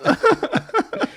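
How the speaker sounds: high, loud laughing yeah. Real, not acted. Laughing hard at remark.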